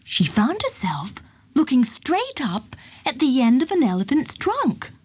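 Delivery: ordinary delivery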